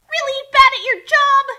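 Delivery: loud, high battle cry; shouted insult. Acted. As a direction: yell it as you strike. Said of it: "shouted insults in battle".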